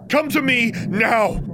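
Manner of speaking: high forceful command. A narrator's reading. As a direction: order her to come, no argument. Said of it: "Alexandra's voice was a command".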